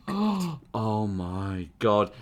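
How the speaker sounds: gasp, then very slow dread; imagine waking up mortified, drawn out; dawning horror. Pretend.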